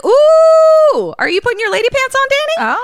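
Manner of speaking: very high, loud teasing squeal; playful high-pitched taunting cheer; mock-scandalized whoop. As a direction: whoop it up, hammed-up and fast. Teasing reaction to Danny's jab.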